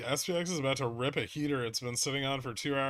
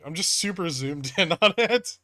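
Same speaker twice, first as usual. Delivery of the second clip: laughing; 4 semitones higher, 7 dB louder than usual. Real, not acted. Laughs at own chart.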